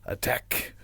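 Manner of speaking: breathy whisper tacked on as a joke; whispered punchline. Pretend. Host whispers a joke nickname for the guest, hushed.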